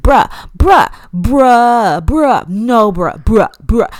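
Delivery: loud, repeated teen slang, mocking the twins; mock teen bruh. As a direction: bark out bruh like a bored teenager. Mimicking twins saying bruh.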